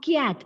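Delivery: "cat" turned into "kyat"; intrusive y. Shown as a demonstration. Teacher naming the mispronunciation to avoid, intrusive y. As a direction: put a y glide between c and vowel in "cat". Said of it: "That is a mispronunciation".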